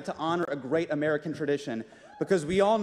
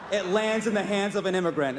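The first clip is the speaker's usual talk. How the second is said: ordinary talk, nothing marked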